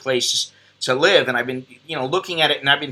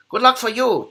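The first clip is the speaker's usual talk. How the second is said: Bruce Lee impression; 9 semitones higher than usual. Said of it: "my best or my worst Bruce Lee impression".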